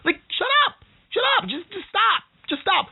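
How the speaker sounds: high, sharp exasperation; fed-up outburst, pitched high; exasperated shut up. Real, not acted. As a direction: snap it off in disbelief. Exasperated retort.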